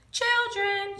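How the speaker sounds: high, loud sung-out call. Acted. Teacher calling the children together, loud. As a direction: call the whole class. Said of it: "she called".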